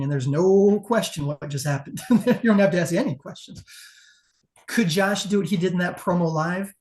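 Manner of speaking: ordinary delivery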